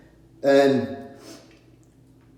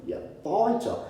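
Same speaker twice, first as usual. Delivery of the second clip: usual speaking voice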